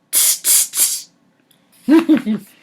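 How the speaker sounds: whispered tss tss; cheeky tsk at the peddler; monkey tsking. Acted.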